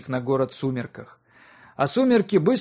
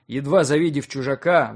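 usual speaking voice

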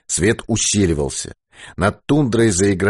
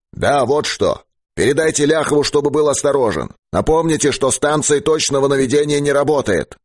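high, raised commanding voice; chief giving orders; 6 semitones higher than usual. Acted. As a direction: send an instruction over the radio, pitched up. Chief dictates warning over radio. (начальник отдаёт приказ по связи высоким голосом)

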